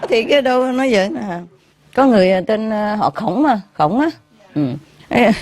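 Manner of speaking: usual speaking voice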